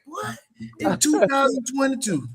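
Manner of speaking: shocked disbelief. Retold or imitated. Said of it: "I'm like".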